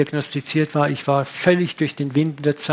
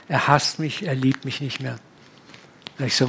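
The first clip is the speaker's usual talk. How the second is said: ordinary talk, nothing marked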